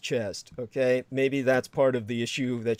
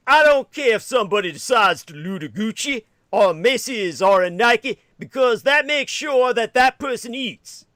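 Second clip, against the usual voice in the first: higher, louder, put-on voice ridiculing her; mock impression of the activist; 8 semitones higher, 5 dB louder than usual. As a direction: ham up her words in a mocking imitation. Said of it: "to quote a she ... I'm gonna do it".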